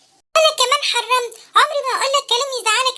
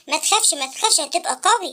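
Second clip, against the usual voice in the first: put-on much lower, gentle old man; wise old inventor voice; 9 semitones lower than usual. Uncle Abqarino asking the sad boy why, low. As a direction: ask like a kindly old uncle.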